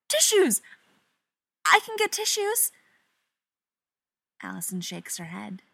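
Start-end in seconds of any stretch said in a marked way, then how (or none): 0.1-2.7 high, loud burst of panic; frantic offer. Acted. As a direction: blurt it out like a lifeline. Panicking Stiles offers tissues.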